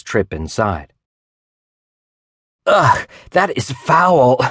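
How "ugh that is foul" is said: high, slow, drawn-out disgusted groan. A narrator's reading. Abby reeling back from the dusty room, disgusted. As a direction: recoil from the stink. Dust cloud, coughing after.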